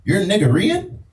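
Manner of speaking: high, eager voice of a stranger guessing; lightly voiced eager stranger; curious stranger. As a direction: play the nosy guy who thinks he knows you. Stranger quizzing him.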